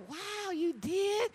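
slow, high mock wonder; fake interest. Faking interest to a coworker, slow high mock wow. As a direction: act amazed you don't feel. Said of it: "just act interested".

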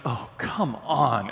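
low, slow, exasperated groan of words; imitated weary protest. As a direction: drag it out in reluctant disbelief. Reluctant reply to being sent out.

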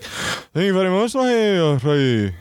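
high lilting sing-song thanks; mock a man who sings every phrase; sing-song stranger. Man thanking someone holding the door, high sing-song. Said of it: "singing everything".

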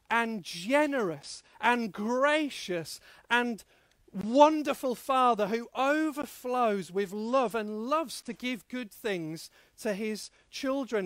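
ordinary talk, nothing marked

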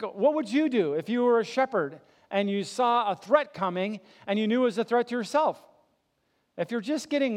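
full-force pulpit preaching, pleading with the crowd; impassioned preaching. Acted. Preacher retells the parable forcefully.

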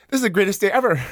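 high and loud, gushing with delight; overjoyed. Retold or imitated. Kid vouched for by the toughest guy, a high, loud thrilled gush. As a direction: sell it like the best moment of your life. Thrilled retelling of bully thrown.

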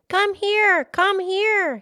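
high, loud desperate call. Acted. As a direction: shout for help into the dark. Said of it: "he called".

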